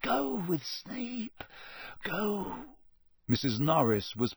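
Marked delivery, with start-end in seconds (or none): 0.0-2.6 slow, high, hushed plea; soft, drawn-out, desperate begging; silent pleading. A narrator's reading. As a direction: urge him away under your breath. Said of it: "Harry pleaded with him silently".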